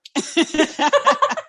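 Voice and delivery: a bright high burst of laughter. Real, not acted. Host teasing listeners about homework, high laugh. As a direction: laugh high at your own joke.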